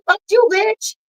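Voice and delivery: quoted insult. Retold or imitated. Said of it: "She said".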